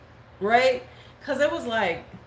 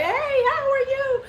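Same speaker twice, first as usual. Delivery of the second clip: gushing greeting; 3 dB louder than usual. Retold or imitated. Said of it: "she was like".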